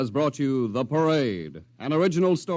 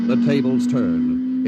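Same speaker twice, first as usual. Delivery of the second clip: louder, slow, weighty announcer reveal; dramatic emphasis; 5 dB louder, slower than usual. Acted. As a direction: land it like a twist. Dramatic announcer teaser line.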